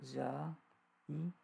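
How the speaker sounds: "za'idatun" very slow, a pause between syllables. Shown as a demonstration. Teacher sounding out a term for students, syllable by syllable. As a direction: break "za'idatun" into long, separate syllables.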